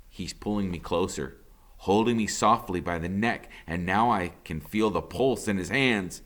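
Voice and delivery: usual speaking voice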